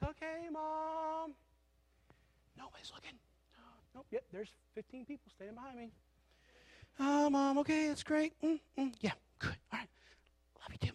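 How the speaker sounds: put-on high, hushed kid squirming in mom's hug; embarrassed kid. Kid hugged by mom outside school, embarrassed and hushed. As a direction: mutter it high and small, wanting out. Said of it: "you don't wanna be hugged".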